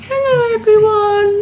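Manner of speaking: high squeaky puppy greeting; voicing the dog.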